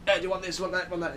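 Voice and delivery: lightly put-on busy city voice; upbeat, up-paced patter. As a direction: rattle it off like an eager seller. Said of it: "upbeat and up-paced".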